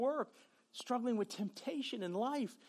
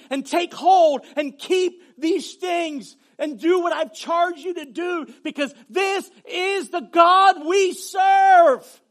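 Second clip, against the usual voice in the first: loud, rising preacher's shout; preaching at full force; 9 semitones higher, 16 dB louder, slower than usual. Acted. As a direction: build to a fervent shout.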